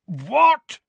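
sharp shocked "what", high and loud. A narrator's reading. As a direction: jolt of disbelief. Hagrid shocked exclamation.

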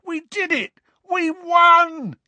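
very high, loud, overjoyed shout; triumphant yell. A narrator's reading. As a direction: scream the victory to the crowd. Ron triumphant after the match.

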